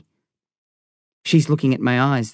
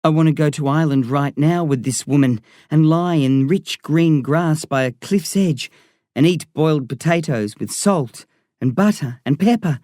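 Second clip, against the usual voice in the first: ordinary delivery, nothing marked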